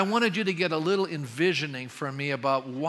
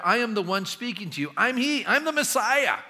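high, rising to a loud proclamation; emphatic declaration; 6 semitones higher, 3 dB louder than usual. Acted. Preacher voicing Jesus revealing himself to the woman, emphatically declaring. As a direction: build in force and pitch to the big reveal.

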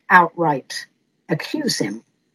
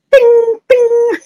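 high, chirpy ping sound effect; ping it out high like a bell; high ping noises; 12 semitones higher, 4 dB louder than usual. Shown as a demonstration. Host mimics egos pinging, high chirpy pings.

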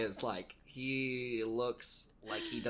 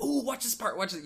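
excited gasp; 10 semitones higher, 4 dB louder than usual. Retold or imitated. Acting out excited movie watcher.

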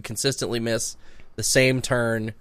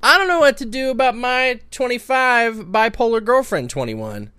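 high put-on voice reading the letter; 12 semitones higher, 6 dB louder than usual. Cohost reading the listener's letter, high put-on voice. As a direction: read the letter in a lifted, performed voice.

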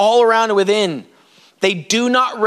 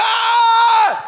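very high, drawn-out "ah"; acted high cry of worship; awed outcry; 12 semitones higher than usual. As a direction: wail it out in overwhelmed wonder. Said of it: "And they go".

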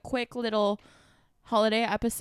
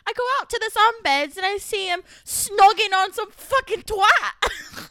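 put-on loud, higher British accent rant; mock British islander; 6 semitones higher, 6 dB louder than usual. Playing a Love Island contestant telling of a betrayal, British accent. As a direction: tell the betrayal like a reality show star. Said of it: "you just killed that".

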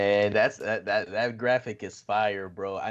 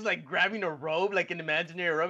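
ordinary talk

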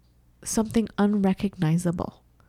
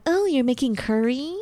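nosy guest; 7 semitones higher than usual. Retold or imitated. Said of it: "They're like".

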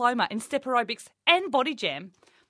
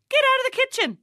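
much higher, loud bark; shouted; 6 dB louder than usual. Acted. Coach shouting tough love at Hannah, loud and high. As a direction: yell it like a drill sergeant.

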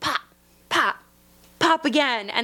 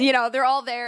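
ordinary talk, nothing marked

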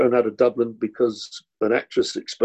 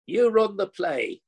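higher, slow, lordly send-off; a playful imitation of the director, higher and slower; mock director's order; 7 semitones higher, slower than usual. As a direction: hand the play over grandly, then bow out to bed. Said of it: "And he said".

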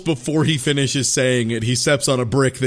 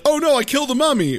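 high, fast panicked wail; hammed-up kid in a panic, pitched high; mock panic; 13 semitones higher, faster than usual. Kid panics over killed mummy.

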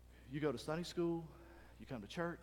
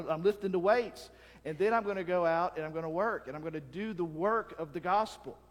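usual speaking voice

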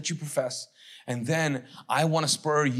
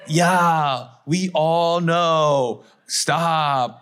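louder, slow, fed-up retort; exasperated loud retort; 2 semitones higher, 7 dB louder, much slower than usual. Real, not acted. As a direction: call them out, drawn out and loud. Mocking the self-declared critic.

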